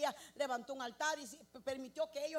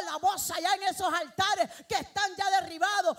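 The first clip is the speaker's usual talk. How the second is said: loud, rising preacher's call to the congregation; full-force preaching; 3 semitones higher, 10 dB louder than usual. Acted. Preacher rallying the church to rebuild altars, full-force preaching. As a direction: preach it out, louder and louder.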